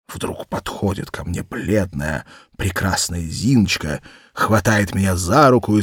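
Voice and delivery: ordinary delivery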